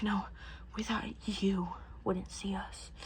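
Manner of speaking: very soft, whispered admission; mock whisper. Men answering Odysseus about eating sheep, mock hushed whisper. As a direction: whisper it as a sheepish retold excuse.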